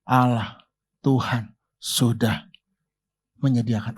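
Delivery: low, slow, soft answer to his son; slow and hushed, full of trust; Abraham quietly telling his son that God will provide; Abraham's quiet faith. Retold or imitated. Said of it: "Abraham berkata apa".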